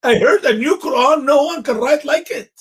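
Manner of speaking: sarcastic delighted gushing; mock amazement. Teasing his cohost's made-up verse, mock amazement. Mocking a new Quran claim.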